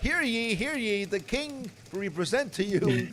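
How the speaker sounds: high-pitched royal herald's proclamation; mock town crier. Host announcing a beef pun to the king's court, mock herald.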